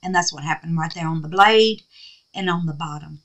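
usual speaking voice

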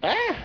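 short startled high-pitched cry; sudden high yelp. Real, not acted. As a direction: yelp in surprise.

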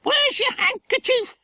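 very high squawky parrot scolding like a nanny. A narrator's reading. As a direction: screech it like a bossy parrot telling off a pup. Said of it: "Kiki demanded of Button".

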